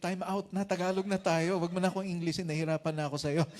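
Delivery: loud, overwhelmed mock protest; exasperated plea. Trainer begging his trainee to stop the English, mock exasperation. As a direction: beg loudly for a break, half joking.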